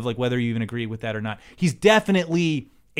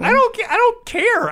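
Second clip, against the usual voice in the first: exasperated high outburst; 7 dB louder than usual. Real, not acted.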